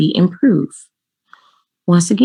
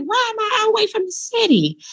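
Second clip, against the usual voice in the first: much higher, disbelieving question; incredulous; 5 dB quieter, faster than usual. Retold or imitated. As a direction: ask it in high-pitched disbelief. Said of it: "I'm like".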